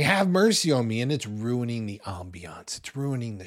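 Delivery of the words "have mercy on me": mild mock yell. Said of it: "and he's yelling".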